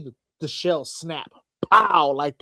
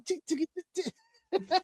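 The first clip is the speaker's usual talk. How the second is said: commercial jingle; 11 semitones higher, 9 dB quieter, slower than usual. Retold or imitated. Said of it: "old sneaker commercial".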